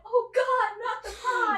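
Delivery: fake-sob over the ruined pie; over-the-top mock crying; mock despair. Mock grief over baking show.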